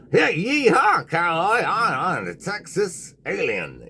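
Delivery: high, loud hammed-up Texan yeehaw; mock Texan cowboy accent. Podcast host jokes about aliens with regional accents, mock Texan drawl. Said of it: "we want some regional accents".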